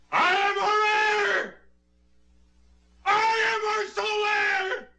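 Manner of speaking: slow, high, manic scream. Acted. Killer screams his confession.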